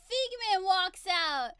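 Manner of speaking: big slow exclaimed reveal; dramatic excited reveal. Retold or imitated. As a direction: announce the star's entrance, stretching the words.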